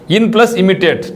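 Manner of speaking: ordinary talk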